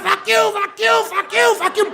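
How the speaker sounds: high, shouted back-and-forth curses; shouting match. Retold or imitated. Nuns and groundskeeper trading high, shouted curses. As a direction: yell it like a screaming match.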